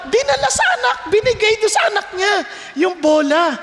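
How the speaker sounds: ordinary talk, nothing marked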